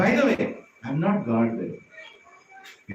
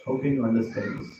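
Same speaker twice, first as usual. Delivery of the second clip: normal talking voice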